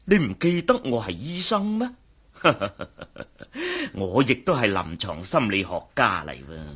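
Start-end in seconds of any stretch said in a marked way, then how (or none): 2.4-3.9 short high chuckle; a quick amused laugh at his own remark; doctor chuckling at his own boast. Acted.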